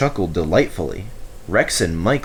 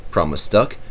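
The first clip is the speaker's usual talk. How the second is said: plain reading voice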